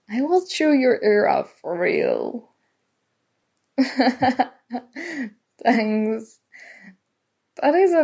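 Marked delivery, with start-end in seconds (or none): 3.8-4.4 short bashful giggle; a small sheepish chuckle; embarrassed laugh. Acted. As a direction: laugh it off after rambling too long. Embarrassed after oversharing.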